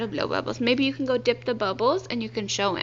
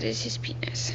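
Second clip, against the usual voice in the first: hushed, lower unvoiced whisper; embarrassed whisper; 8 dB quieter than usual. Real, not acted. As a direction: whisper it so nobody overhears. Embarrassed aside to child.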